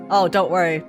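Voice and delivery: ordinary delivery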